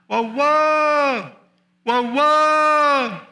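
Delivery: high, sustained long-distance holler; imitated farm holler. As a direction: holler it out high, as if across forty acres. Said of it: "he might holler something like".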